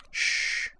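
gentle whispered shh to calm a frightened lover; murmured shush. A narrator's reading. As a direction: hush him in a whisper. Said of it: "Azalin murmured".

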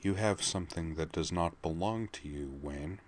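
Laura warning Wayne, quiet and menacing; quiet menace. Acted. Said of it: "her voice quiet but full of menace".

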